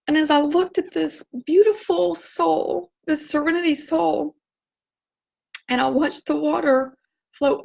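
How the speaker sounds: tearing up while speaking slowly; teary. Real, not acted. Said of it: "I'm getting, like, really teared up".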